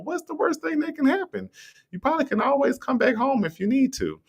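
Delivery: very high, light what's-the-worst; high reassurance. Acted. To someone scared of moving abroad, high easy reassurance. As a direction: lift it up to shrug off the fear.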